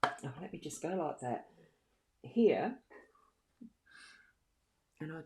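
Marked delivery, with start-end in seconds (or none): none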